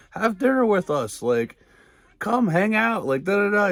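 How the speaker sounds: high, sing-song imitation of eager hosts; mock friendly invite. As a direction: parody them pleading him to stay.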